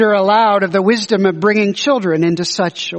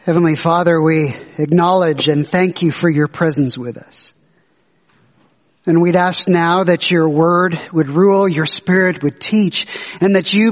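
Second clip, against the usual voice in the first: prayer voice; 4 semitones lower than usual. Acted. Leads a prayer.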